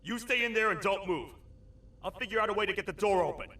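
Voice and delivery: high, loud, rushed calling; urgent shout. Acted. Toad shouting to partner through locked door. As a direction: yell through the door, hurrying.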